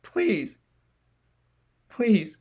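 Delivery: high, broken pleading; tearful begging. Acted. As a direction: beg through tears. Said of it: "teary-eyed".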